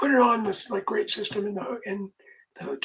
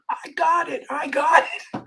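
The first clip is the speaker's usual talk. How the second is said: mouthed excitedly; 5 semitones higher, 3 dB louder, slower than usual. Retold or imitated. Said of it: "his mouth is going".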